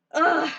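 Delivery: loud, high cry of relief; relieved cry. Real, not acted. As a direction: let the tension out in one cry. Relief cry after the win.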